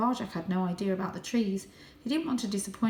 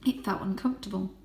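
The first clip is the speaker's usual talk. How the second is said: ordinary delivery, nothing marked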